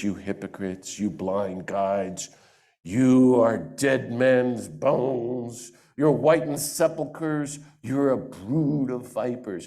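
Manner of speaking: mock yelling Jesus. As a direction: drag out each insult like a preacher scolding. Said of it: "everybody thinks Jesus in Matthew is yelling".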